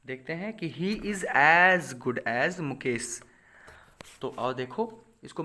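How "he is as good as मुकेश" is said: very slow, each word spaced out; slow dictation. Shown as a demonstration. Teacher to class, dictating the example very slowly. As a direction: read the example so students can write it.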